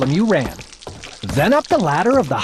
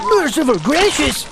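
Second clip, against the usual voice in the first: put-on much higher, startled old man; gulping shock; 10 semitones higher than usual. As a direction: gasp it out. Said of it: "he gulped".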